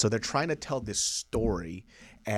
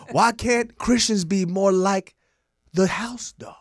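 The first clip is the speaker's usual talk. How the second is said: raised, earnest pleading sermon; mock preacher; 9 semitones higher than usual. Asking why Christians hide love, high mock preaching. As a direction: build rhetorical questions like a preacher.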